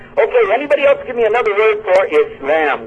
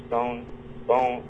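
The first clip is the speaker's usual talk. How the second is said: much softer, savouring the rhyme; quiet rhyming aside; 5 semitones lower, 10 dB quieter than usual. Real, not acted. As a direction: murmur the rhyme to yourself. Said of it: "I can work that into some kind of poetry".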